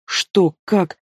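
two separate, puzzled questions; mildly lost and confused; a touch of stunned disbelief; bewildered mumble. A narrator's reading. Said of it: "пробормотала я растерянно".

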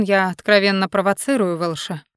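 plain reading voice

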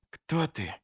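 soft frightened whisper. A narrator's reading. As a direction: breathe the question. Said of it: "прошептал он". (испуганный шёпот)

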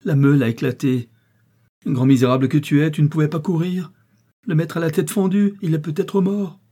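high, loud frantic reproach; distraught scolding. A narrator's reading. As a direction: break the bad news while berating him. Worker berates latecomer after accident.

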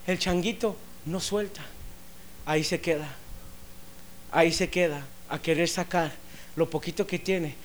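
very soft, low narration of a parable; hushed sermon storytelling. Acted. Preacher telling how the little monkey gets trapped, hushed storytelling. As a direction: drop right down and tell it quietly.

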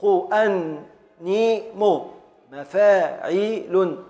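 slow, syllable-by-syllable chant of the metre; drawn-out rhythmic scanning; metrical scansion. Shown as a demonstration. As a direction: stretch each long syllable and split the beats. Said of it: "على وزن".

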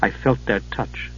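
hushed and unsettled; softly. A narrator's reading. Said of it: "Palin said softly".

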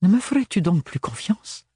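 hurt, accusing character voice; reproachful. A narrator's reading. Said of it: "reprocha-t-elle".

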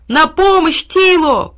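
high, loud cry for help; desperate shout. A narrator's reading. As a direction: scream for rescue in danger. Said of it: "крикнул Тильтиль".

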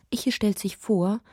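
usual speaking voice

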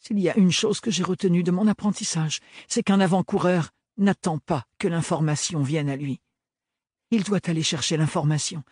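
formal, careful, earnest plea; earnest young apprentice. A narrator's reading. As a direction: defend yourself politely but firmly. Ophélie speaking up. (jeune apprentie sérieuse)

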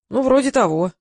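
narrated half-hearted agreement; reluctant. A narrator's reading. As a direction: admit it unwillingly. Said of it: "нехотя признался Полканов".

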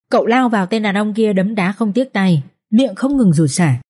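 usual speaking voice

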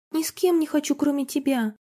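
put-on high, pouting girl's voice; a small girl digging her heels in; girl refuses music lessons stubbornly; stubborn little girl.

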